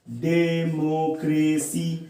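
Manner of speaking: Democracy slowly, beat by beat without a long break; democracy drawn out evenly for a class; split into syllables. Shown as a demonstration. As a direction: give every syllable of democracy its own length. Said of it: "तीन बार में pronounce कर पा रहे हैं".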